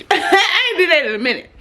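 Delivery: words through a laugh. Real, not acted. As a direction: laugh while saying it. Laughing at herself.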